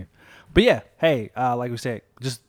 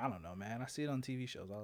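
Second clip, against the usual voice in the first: whisper; 5 semitones lower, 16 dB quieter than usual. Real, not acted. Whispered reply.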